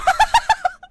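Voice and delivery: loud, high burst of laughter; high shrieking laughter. Real, not acted. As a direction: crack up, shrieking. Explodes laughing at wife's joke.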